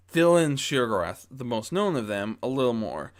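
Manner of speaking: ordinary delivery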